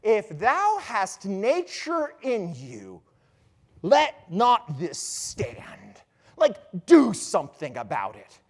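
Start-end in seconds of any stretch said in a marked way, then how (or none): 0.0-6.0 very slow, drawn-out ghostly charge; stately, stretched-out stage voice; ghost's solemn command. Acted. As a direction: intone it like a ghost, stretching each word. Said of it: "the ghost looks at Hamlet and says".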